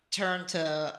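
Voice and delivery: ordinary talk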